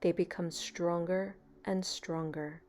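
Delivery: calm, even meditation voice with long pauses; guided meditation. Real, not acted. Meditation leader easing a beginner into relaxation, calm and unhurried. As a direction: guide them gently into stillness, leaving space between phrases.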